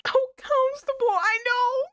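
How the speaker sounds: high, teary voice; tearful. Real, not acted. Player reading chat while still crying, teary. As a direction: talk through tears. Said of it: "you are crying".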